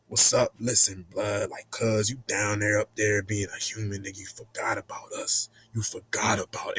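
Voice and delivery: low, quiet, murmured street voice; spirit babies talking. Retold or imitated. Spirits telling him he forgot them, low hushed voice. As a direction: keep it low and soft, confronting. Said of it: "talking about".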